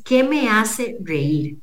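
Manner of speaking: pregunta lenta, cada palabra marcada; slow, deliberate question. Shown as a demonstration. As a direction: draw out each word so it sinks in. Announcing the key question, slowly. (pregunta dicha muy despacio y con énfasis)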